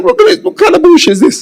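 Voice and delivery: high, loud disbelieving protest; incredulous outrage. Retold or imitated. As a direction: sound floored by the insult. Outraged at travel expenses only.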